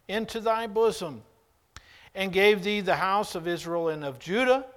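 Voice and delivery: loud, commanding voice of God. Acted. Preacher booming God's rebuke to David. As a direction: proclaim it as God rebuking a king. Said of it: "God is speaking".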